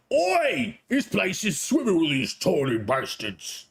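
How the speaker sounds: a hint of a rough Cockney goblin hunter; gruff character. Shown as a demonstration. Streamer acting the trailer character live, mild gruff voice. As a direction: bark it like a rough brute. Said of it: "How about I read it".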